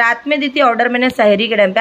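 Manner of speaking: usual speaking voice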